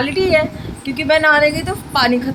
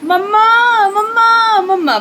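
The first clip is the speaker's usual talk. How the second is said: high, loud whiny Mamma call; imitating child calling; 11 semitones higher, 7 dB louder, much slower than usual. Mother imitating her daughter's whiny Mamma calls, high and loud. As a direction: mimic a toddler crying for Mamma. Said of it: "एक और काम है".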